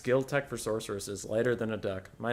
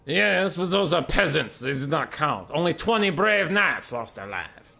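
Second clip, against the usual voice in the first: haughty Bretonnian; 5 semitones higher, 5 dB louder, slower than usual. Acted. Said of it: "the Bretonnian".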